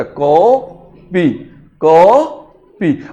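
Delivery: "को" held long, then a separate "पी"; slow "को", pause, "पी", each set apart; correct long vowel. Shown as a demonstration. Teacher repeating the model for students, drawn-out "को", then "पी". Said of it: "को पी बोला जाता".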